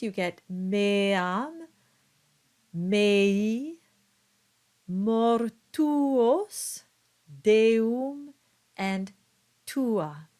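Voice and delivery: meam, mei, mortuos, deum, tua said slow, each vowel kept; separate double vowels. Shown as a demonstration. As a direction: spell out both vowels clearly. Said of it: "keep the original sound of each vowel".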